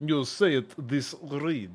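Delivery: slow put-on Russian accent. Shown as a demonstration. Streamer showing viewers an accent he can do, Russian accent. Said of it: "I can do a Russian accent".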